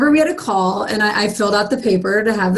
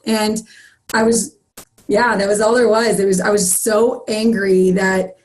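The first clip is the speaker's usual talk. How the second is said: usual speaking voice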